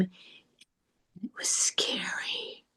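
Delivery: slow scared whisper. Acted. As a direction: breathe it out in fear. Scared line in Lovecraftian scene.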